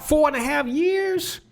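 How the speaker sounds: slow rising disbelief on Four; can't believe it took four and a half years; incredulous. Real, not acted. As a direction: stretch Four out, high and amazed. Disbelief at the long case.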